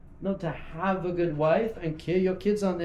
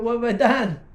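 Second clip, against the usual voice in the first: freaking out; 4 semitones higher, 7 dB louder than usual. Acted. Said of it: "Hashem must be freaking out up there".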